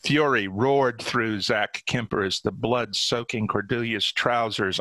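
ordinary delivery, nothing marked

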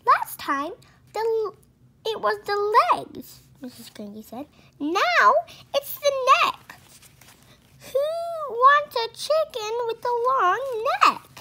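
high, loud scolding complaint; complaining. Acted. Wife scolding the failed medicine, loud and complaining.

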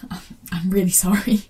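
normal talking voice

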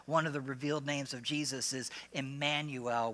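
ordinary delivery

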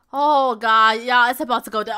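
loud, excited build-up; hyped up. Real, not acted. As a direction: hype the coming showdown at full volume. Narrator hyping the coming showdown.

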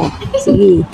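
normal talking voice

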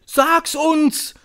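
high, loud eager plea; calling crowd. A narrator's reading. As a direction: shout it as a begging audience. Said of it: "riefen sie".

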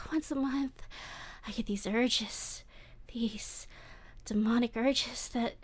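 creepy lovesick voice, drifting into breathy whispers; obsessive captor. Acted. Captor confesses love to tied-up Wolfie, creepy and hushed. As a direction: confess your obsession to your captive, unhinged.

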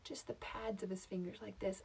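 soft breathy whisper. Shown as a demonstration. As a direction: hush it like a secret. Hushed, tense storytelling moment.

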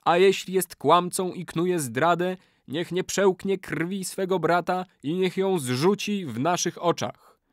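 high-pitched incantation; ritual curse. A narrator's reading. Old man curses a lying brother, incanting. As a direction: chant the curse over them.

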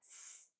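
bare, sustained S sound; speech sound S. Shown as a demonstration. Teacher gives pupil the first sound of a word, held S sound. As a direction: say "s" as a held hiss, not the letter name. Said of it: "zaczyna się od głoski".

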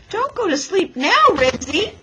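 loud, high, desperate plea; begging. A narrator's reading. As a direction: beg the dog urgently. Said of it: "begged Henry".

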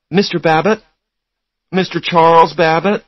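put-on high-pitched girl caller; girl's voice. A narrator's reading. Girl phoning Charlie to check who he is, girl's voice. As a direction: ask as a girl, pitched well up. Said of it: "It was a girl's voice".

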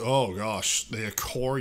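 usual speaking voice

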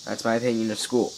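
ordinary talk

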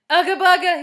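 loud, high silly yell; nonsense outburst. A narrator's reading. Myron breaking the classroom silence, loud silly outburst. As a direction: blurt it out at full volume.

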